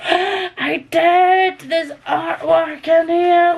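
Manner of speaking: high, drawn-out amazed delight; gasp, then gush high and thrilled; excited discovery. Real, not acted. Reader finds hidden artwork in the book, thrilled.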